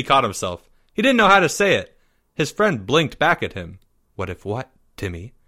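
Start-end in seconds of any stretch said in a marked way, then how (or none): 4.1-5.3 low, soft question; puzzled Leo. A narrator's reading. Boy asking his faltering friend, low and puzzled. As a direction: quietly prompt him to go on.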